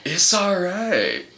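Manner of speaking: slow, drawn-out mellow drawl; blissed-out stoner reassurance; hippie Jesus. Acted.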